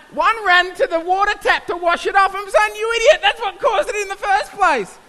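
high loud frantic telling; excited high retelling. Retold or imitated. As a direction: tell it in a rush of panic. Retelling students' panic.

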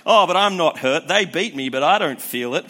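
put-on loud, dazed drunk boasting; loud drunk bravado; mock drunk. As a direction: brush it off loudly like a drunk. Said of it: "you'll say".

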